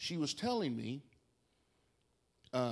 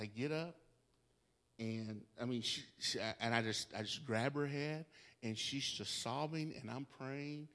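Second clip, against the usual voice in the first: normal talking voice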